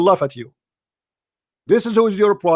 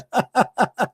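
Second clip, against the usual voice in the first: scornful laugh; 3 semitones higher, 3 dB quieter than usual. Real, not acted. Host laughs at caller.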